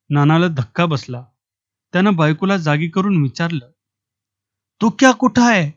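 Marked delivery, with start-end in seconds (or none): 4.8-5.7 alarmed father. A narrator's reading. Father finds son missing at night.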